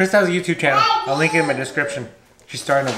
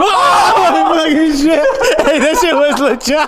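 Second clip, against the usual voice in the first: high, loud scared yelling; startled shout; 10 semitones higher, 5 dB louder, slower than usual. Real, not acted. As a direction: yelp after a jump scare. Jump scare reaction.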